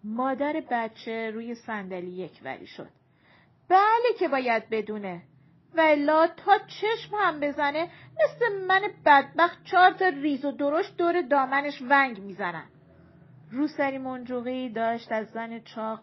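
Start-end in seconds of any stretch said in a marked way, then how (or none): 3.7-12.7 loud, emphatic grumbling of a fed-up mother; indignant complaint. A narrator's reading. Woman lamenting her many children, indignant. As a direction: complain loudly to the whole company.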